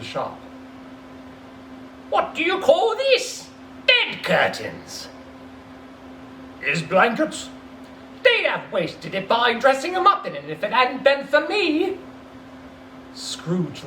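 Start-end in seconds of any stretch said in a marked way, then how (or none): 2.1-5.1 much higher, slow crone voice; a greedy old woman showing off loot; woman thief, cackling. Acted. Woman selling the stolen curtains, high crone voice. 6.6-12.0 higher mocking old woman voice; woman thief, defiant. Thief defending herself to the dealer, high and brazen.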